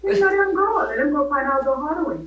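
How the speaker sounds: high, loud, fervent declaration; passionate preaching. Real, not acted. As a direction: preach it with rising fire. Guest preaching with rising force.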